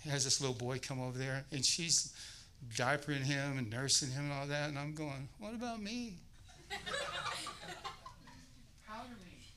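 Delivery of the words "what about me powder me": put-on high, soft whining like a needy baby; whiny jealous husband. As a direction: beg like a jealous grown man-child, with a giggle. Jealous husband wants wife's care.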